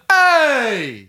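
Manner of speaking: big, high New Jersey holler; drawn-out, hammed-up New Jersey accent. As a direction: bellow it like a New Jersey local. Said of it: "that was a good accent right there".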